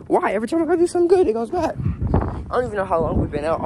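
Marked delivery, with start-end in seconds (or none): 0.1-1.8 high, loud wail of frustration; exasperated outburst. Real, not acted. Owner lamenting good deeds gone bad, exasperated outburst. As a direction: cry out high in protest. Exasperated complaint after mishap.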